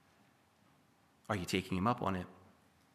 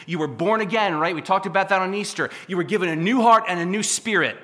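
louder, higher, fired-up sermon; preaching at full force; 5 semitones higher, 7 dB louder than usual. Real, not acted. Preacher pleading with the congregation to turn, fired up. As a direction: pump the room up and drive it home. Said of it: "I'm getting pumped and I'm sweating".

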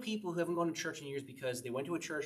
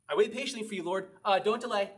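mock impatient David; 5 semitones higher, 4 dB louder than usual. Mocking David's two moods.

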